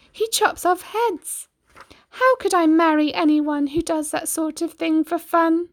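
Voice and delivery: be shocked and heartbroken; Cindy's dismayed thoughts. Acted. Cindy thinking about the prince, high and aghast. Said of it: "she thought".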